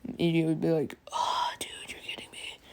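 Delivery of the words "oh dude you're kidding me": very soft, voiceless groan; hushed dismay. Retold or imitated. Classmate reacting to the reckless rival, whispered dismay. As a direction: whisper it in weary disbelief.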